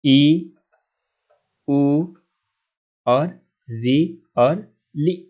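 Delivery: ई, उ, री, लि each called out short and loud; short vowels. Shown as a demonstration. Teacher calling out short vowels for the class. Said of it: "स्पष्ट कर दिया कि अ".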